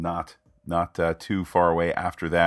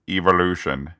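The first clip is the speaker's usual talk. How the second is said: lightly imitated actor's line, a touch louder; Patrick Stewart quote; 5 dB louder than usual. Podcaster quoting an actor to his co-host, light impression. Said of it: "to quote Patrick Stewart".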